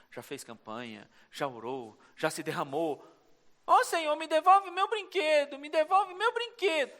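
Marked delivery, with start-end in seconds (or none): 3.7-6.9 put-on high, begging like a child; childish whining. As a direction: plead for the toy like a kid. Believer whining again. (fiel implorando como criança)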